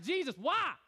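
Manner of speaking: half-serious freaked-out cry; yelling back. Retold or imitated. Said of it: "I'm, like, yelling back".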